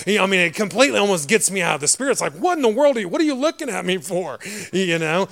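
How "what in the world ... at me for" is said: high, loud mock bewilderment; exasperated disbelief. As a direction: act out exasperation at being stared at. Mock reaction to starers.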